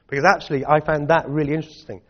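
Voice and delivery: ordinary talk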